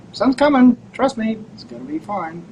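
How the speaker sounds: bright high reassuring voice; cheerful reassurance. Retold or imitated. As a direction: sing it out, sure the sun's coming. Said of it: "I go".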